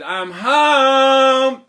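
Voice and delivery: high, very loud, drawn-out call; hollered homecoming. Acted. Jack opening the door to his mother, long holler. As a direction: sing out a big arrival shout.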